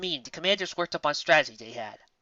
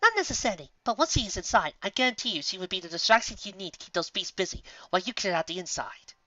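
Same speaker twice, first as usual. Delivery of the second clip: higher refined voice; sly and amused; Rarity grinning about Pinkie; Rarity, knowing grin; 5 semitones higher, 4 dB quieter than usual. A narrator's reading. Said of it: "Rarity grinned".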